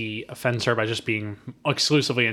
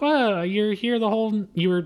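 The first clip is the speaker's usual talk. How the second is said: high mock Nancy Allen; 9 semitones higher, slower than usual. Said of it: "she's like".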